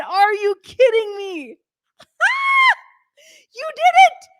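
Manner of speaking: high, loud, near tears with joy; overjoyed. Real, not acted. Host thanking viewers for the chart jump, overjoyed. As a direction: disbelief spilling into a joyful cry. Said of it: "I'm freaking out".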